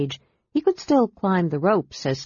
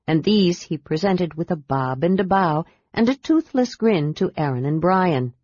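ordinary delivery, nothing marked

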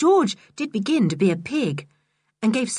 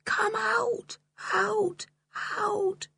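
fading echo; 13 semitones higher, 6 dB quieter, much slower than usual. A narrator's reading. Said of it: "whispered the echoes".

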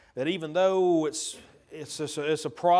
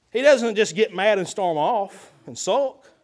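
usual speaking voice

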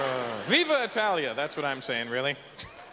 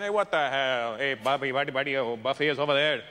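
Italian guy; 3 semitones lower than usual. Retold or imitated. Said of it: "an Italian guy going into".

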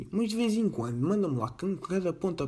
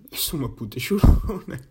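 breaks into a laugh mid-phrase, louder; laughing at himself; 7 dB louder than usual. Real, not acted. Admitting to listeners he cries at videos, laughing. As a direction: laugh through the confession.

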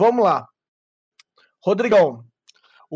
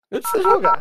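much higher, mocking imitation; 12 semitones higher, faster than usual. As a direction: squeak it out in mockery. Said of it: "o jeito que você falou".

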